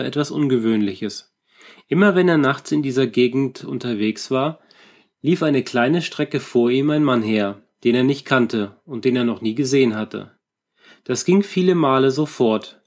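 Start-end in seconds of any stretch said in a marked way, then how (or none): none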